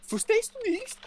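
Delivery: imitating a snappy check-in lady at Frankfurt airport; mock German lady. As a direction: play an irritated German clerk. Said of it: "this German lady was".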